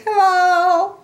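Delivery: very high, loud sing-song hello; Piggy floating hello. A narrator's reading. Piggy up in the air to Gerald, very high hello. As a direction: call down happily from the air. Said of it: "Piggy says".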